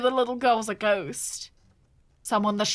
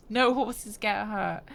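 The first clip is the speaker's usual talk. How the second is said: ordinary talk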